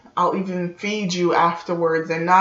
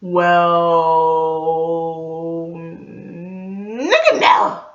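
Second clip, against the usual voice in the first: flat refusal; much slower than usual. Retold or imitated. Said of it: "And I'm like".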